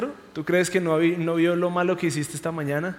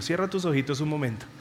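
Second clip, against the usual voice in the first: guided reflection. Acted. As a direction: lead them gently through imagining. Leads a short meditation. (reflexión guiada, suave y pausada)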